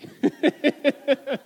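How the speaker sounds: loud amused laugh; laughing at the story's ending; real laugh. Real, not acted. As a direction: warm burst of laughter. Laughs after the punchline.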